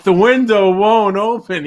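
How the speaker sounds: hammed-up panic at the stuck window; mock bad trip. Friend retelling the bad trip, hammed-up slow panic. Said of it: "he's like".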